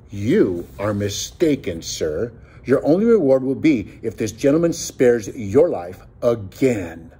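plain reading voice

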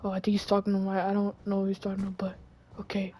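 very soft undertone; hushed hiding voice. Pretend. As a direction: keep your voice down while eavesdropping. Hiding while staff talk.

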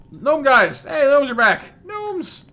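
high, loud delight at the gnomes; happy shout of welcome; excited greeting. Real, not acted.

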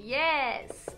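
ordinary talk, nothing marked

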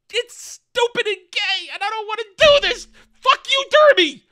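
high, loud fed-up rant; exasperated outburst. Real, not acted. Reviewer losing patience with the show, loud and high. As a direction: throw up your hands and yell at it.

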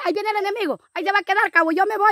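the commander warning the corporal as she flees; excited alarm. Pretend. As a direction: raise the alarm and run off. Enemy arrives, commander flees.

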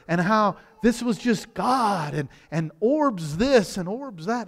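usual speaking voice

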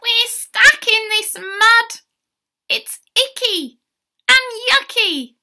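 high, loud cry of disgust; yelping. Acted. Stuck llamas crying for help from the mud, yelping. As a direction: yelp it out in a high character voice. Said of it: "heard them all yelp".

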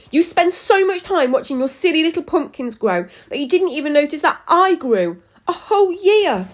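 high-pitched, aggrieved complaint; angry tirade. A narrator's reading. Neglected daughter scolds her parents, angry and high. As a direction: berate them, pitched high throughout.

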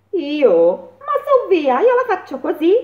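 loud, high, quick hare. A narrator's reading. Hare replying to the mole, high and loud. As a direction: chirp it out like a lively hare.

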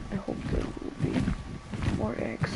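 soft whispered wish; hopeful whisper. Real, not acted. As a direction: whisper it like a secret hope. Hoping for more eggs.

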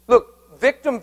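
ordinary talk

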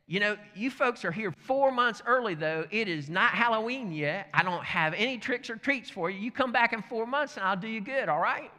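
high, loud, chipper voice; acting out an unbothered, friendly man; cheerful neighbor. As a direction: brush them off with breezy good humor. Acted cheerful brush-off of Klan.

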